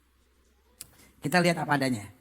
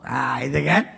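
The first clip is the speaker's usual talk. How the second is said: ordinary talk, nothing marked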